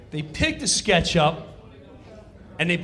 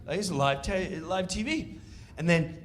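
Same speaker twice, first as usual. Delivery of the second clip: usual speaking voice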